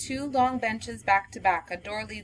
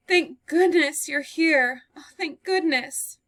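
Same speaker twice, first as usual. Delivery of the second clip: higher, slow and breathless with relief; sobbing, out of breath; 7 semitones higher, 5 dB louder, slower than usual. Acted. Girl bursting in from the storm, breathless and sobbing. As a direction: gasp it out, near tears. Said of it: "She is sobbing and is out of breath".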